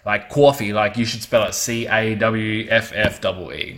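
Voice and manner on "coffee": "coffee" said as "cawrfee", louder; New York coffee. Retold or imitated. Showing the spelling of New York coffee, "coffee" as "cawrfee". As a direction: drawl "coffee" as "kwawr-fee". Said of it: "the way they say coffee".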